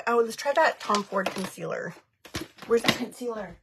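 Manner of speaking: ordinary talk, nothing marked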